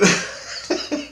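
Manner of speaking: short breathy chuckle; amused laugh. Real, not acted. Laughing at his own comeback, amused. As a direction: let a laugh slip mid-boast.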